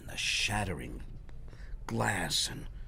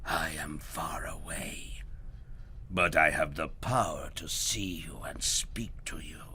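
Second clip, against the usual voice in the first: goblin king; 5 semitones lower than usual. Acted. Goblin king whispering threats to Herschel, menacing. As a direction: whisper a cold threat from far away.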